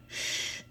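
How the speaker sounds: soft unvoiced shush for silence. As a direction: hush them all so you can hear. Eeyore hushes to listen.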